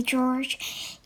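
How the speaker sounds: usual speaking voice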